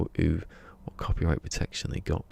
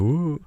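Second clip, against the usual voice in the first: mock rapper whoa; 10 semitones higher, 7 dB louder than usual. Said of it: "they jump up on there and they go".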